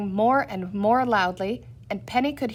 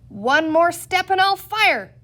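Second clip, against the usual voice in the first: high, loud, slow threat; cried out; 8 semitones higher, 5 dB louder than usual. A narrator's reading. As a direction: warn them off with a cry, slowly. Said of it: "cried Peter".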